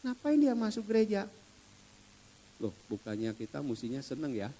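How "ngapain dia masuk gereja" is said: higher, indignant question; mock outrage, pitched up; resentful churchgoer. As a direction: scoff at the repentant sinner. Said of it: "eh, ada yang marah".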